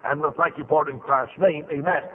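low earnest closing prayer. Real, not acted. Preacher praying over the congregation, reverent prayer. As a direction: pray it reverently to the end.

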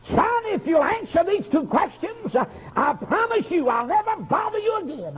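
soft tearful pleading rising to a high wail; mother figure weeping over the boy, then wailing; tearful plea. Retold or imitated. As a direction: beg him through tears, building to a cry. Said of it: "with tears streaming down her cheeks".

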